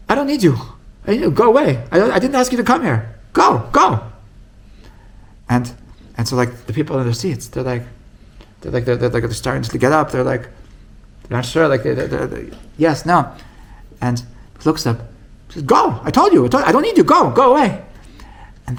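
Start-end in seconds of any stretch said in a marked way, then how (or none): 0.1-4.0 high, loud shout of go away; a rebbe loudly banishing the jester; angry dismissal. Acted. Rishner sends the jester away. 15.6-17.8 high, loud shout of go away; a rebbe loudly banishing the jester; angry dismissal. Acted. Rishner repeats the dismissal.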